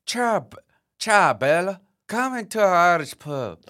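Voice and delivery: mixed Irish and Italian accent, lightly put on; an Irishman pretending to be Italian; hammed-up Irish-Italian blend. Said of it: "weld the two accents into one".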